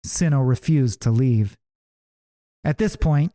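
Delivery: plain reading voice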